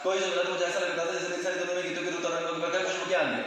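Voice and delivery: very fast recitation; rushed run-on poem lines. Shown as a demonstration. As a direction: race through the whole line. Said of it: "अब मैंने यही चीज fast बोली".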